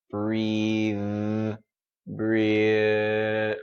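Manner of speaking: "breathe" stretched long with a buzzing voiced TH; voiced ending. Shown as a demonstration. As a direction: hold the voice through the end of "breathe". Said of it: "Keep that voice going".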